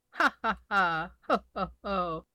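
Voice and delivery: a lightly acted ha-ha then ho-ho laugh; elephant laughing. The elephant laughing at the funniest thing, mild acted laughter. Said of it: "He ho-hoed and ha-ha'd".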